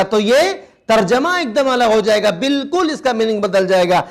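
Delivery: ordinary talk, nothing marked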